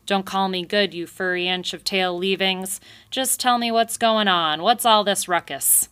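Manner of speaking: loud, gruff telling-off; angry retort. Acted. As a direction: snap back at the mouse, loud. Porcupine snapping at Thistle.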